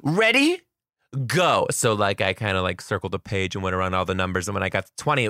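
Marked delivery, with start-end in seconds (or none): none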